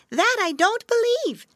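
very high chirpy bird voice; swallow voices. Acted. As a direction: squeak it like little swallows. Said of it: "said they".

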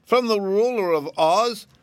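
high, doubting little voice; doubtful rabbit. A narrator's reading. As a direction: question her with suspicion. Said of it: "asked the rabbit doubtingly".

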